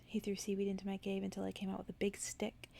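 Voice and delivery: ordinary delivery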